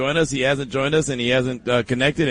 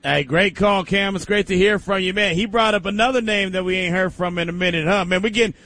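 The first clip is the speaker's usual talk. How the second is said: normal talking voice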